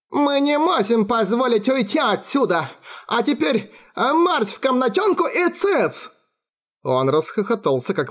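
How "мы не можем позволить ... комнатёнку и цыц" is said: much higher, sing-song mock of the guards; childish sing-song coo. A narrator's reading. Alfred mimicking the guards in a high childish sing-song. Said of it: "поребячески нараспев проворковал".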